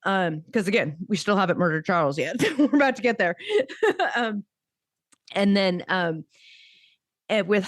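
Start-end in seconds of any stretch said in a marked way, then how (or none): 3.4-4.1 high laugh. Real, not acted. Laughs at own joke.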